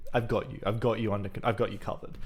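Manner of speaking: ordinary talk, nothing marked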